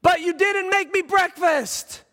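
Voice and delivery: put-on very high, loud kid's wail; upset child. Son objecting to dad about breakfast, loud high-pitched protest. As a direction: mimic a distressed child protesting at the top of the range. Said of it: "he's crying now".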